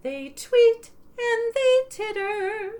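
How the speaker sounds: put-on lilting sing-song read to kids; sing-song picture book reading; storybook read-aloud. A narrator's reading. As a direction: read it like bedtime story time, half singing.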